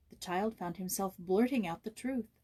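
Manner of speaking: ordinary delivery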